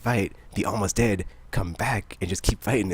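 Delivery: ordinary talk, nothing marked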